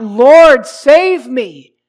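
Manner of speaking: loud, drawn-out desperate plea, pitched high; high, urgent cry for help. Acted. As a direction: call out for rescue at full voice. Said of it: "And then he cries out".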